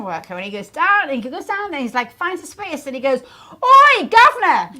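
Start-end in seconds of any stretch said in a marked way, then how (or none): 3.6-4.7 put-on loud Cockney call, British accent; slow shouted Cockney British accent. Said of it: "This is British".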